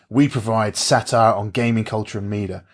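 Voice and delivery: ordinary delivery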